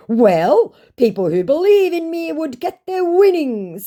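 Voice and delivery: very high, loud scammer protest; exaggerated high-pitched self-defence; mock scammer. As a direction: whine it as the put-on scammer. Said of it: "he said".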